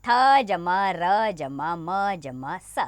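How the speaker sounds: very slow, letter by letter; slow spelling out. Shown as a demonstration. Teacher spells thermos slowly for young pupils. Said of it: "चार वर्णों से बने शब्द".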